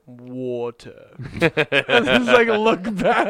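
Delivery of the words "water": low, soft British "Water." with dropped r; granddad's British accent. Retold or imitated. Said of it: "He'll just go".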